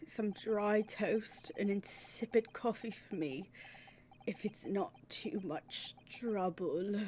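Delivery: put-on slow, soft British accent sinking to a whisper. Player doing a slow British accent for the gloomy detective. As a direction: languid British accent, barely breathing. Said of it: "doing a slow British accent".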